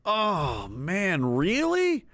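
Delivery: drawn-out doubt, pitch swooping up on really; reluctant acceptance. Retold or imitated. Everyone reacting after the convention, unenthusiastic resignation. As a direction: sag first, then rise in reluctant disbelief. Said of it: "everyone talking was like".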